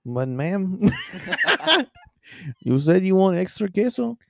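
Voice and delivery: mock sleepy Mexican accent; waiter's badly faked Mexican accent; put-on Mexican accent. Waiter answering a complaining customer, mock Mexican accent. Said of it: "I'm doing an accent".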